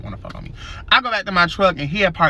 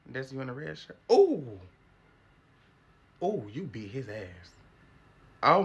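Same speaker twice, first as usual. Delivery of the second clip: a no-nonsense ISS teacher scolding students; sassy detention teacher; 3 semitones higher than usual. Acted. In-school suspension teacher scolding students, sassy and tired. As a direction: run detention like you've seen it all.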